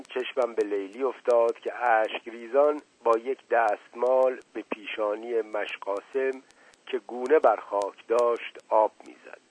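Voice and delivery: usual speaking voice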